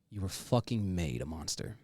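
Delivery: usual speaking voice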